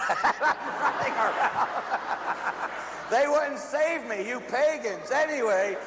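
real laughter breaking through, high-pitched; high mock complaint through laughter; laughing. Real, not acted. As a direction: crack up while telling it. Cracks up telling his silly dream.

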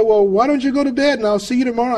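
high-pitched, light dismissal; retold breezy sign-off. Friend on the phone to an unresponsive listener, breezy. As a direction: send them off to bed in a lifted voice.

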